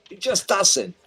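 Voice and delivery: high, loud barker's call; loud carnival barker. Acted. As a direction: bellow it like a carnival barker working the crowd. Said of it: "That's why I speak so loud".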